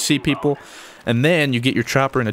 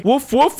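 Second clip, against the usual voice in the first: mock bark; 12 semitones higher, 4 dB louder than usual. Imitates his attack dogs.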